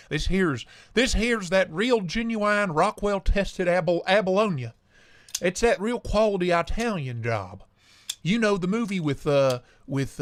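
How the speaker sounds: a hint of a hammed-up Southern salesman; southern accent. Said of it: "stuck in my southern accent".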